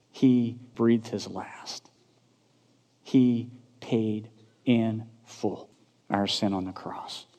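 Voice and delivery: slow, low and soft; solemn hush. Real, not acted. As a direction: drop the voice, weigh each word slowly. Solemn drop after the climax.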